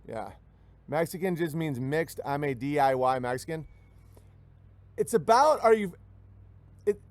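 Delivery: ordinary talk, nothing marked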